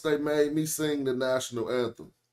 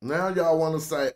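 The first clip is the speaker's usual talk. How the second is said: ordinary talk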